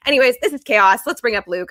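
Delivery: wired, loud, rushing along; jittery from the caffeine; too much caffeine. Real, not acted. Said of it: "I have too much caffeine".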